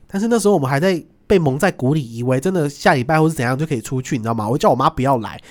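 ordinary talk

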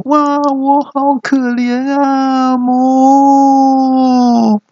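very high, slow mock wail; hammed-up high wailing moo; pitiful cow-husband. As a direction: whine pitifully and end in a long loud moo. Punished husband whines, moos as cow.

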